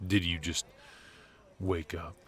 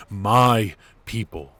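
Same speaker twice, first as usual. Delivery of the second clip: slow, loud, stressed "My people"; emphatic alarm; 4 semitones higher, 12 dB louder, slower than usual. Acted. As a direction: hammer "My people" in disbelief. Shocked realisation of betrayal.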